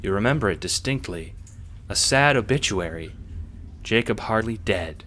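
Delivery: normal talking voice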